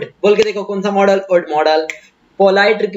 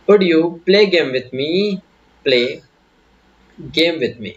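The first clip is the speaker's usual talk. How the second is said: slow teaching example; much slower than usual. Shown as a demonstration. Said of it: "आपने कहा".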